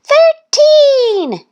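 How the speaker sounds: very high, loud yell. A narrator's reading. Thistle beating Goose to the answer, high yell. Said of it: "she yelled".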